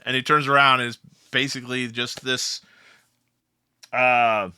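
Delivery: ordinary talk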